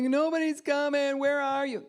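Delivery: high, loud, whiny wail; manipulative cry. Shown as a demonstration. Preacher mocks a manipulative cry to God. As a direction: fake a desperate cry to get attention. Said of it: "There's a manipulative cry".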